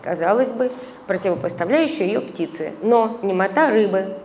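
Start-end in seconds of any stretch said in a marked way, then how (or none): none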